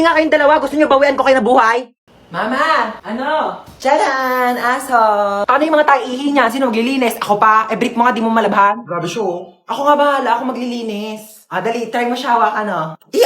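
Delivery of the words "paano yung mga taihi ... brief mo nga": ordinary talk, nothing marked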